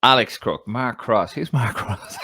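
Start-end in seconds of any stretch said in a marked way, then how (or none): 1.3-2.2 laughing. Real, not acted. Laughing at own name mix-up.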